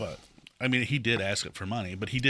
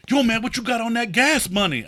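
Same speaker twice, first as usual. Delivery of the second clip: loud, high-pitched hustler's demand; pushy panhandler; 11 semitones higher, 9 dB louder than usual. Retold or imitated. Imitating a dude who jumped out of a car, pushy panhandler. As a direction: call out brashly, imitating a pushy beggar.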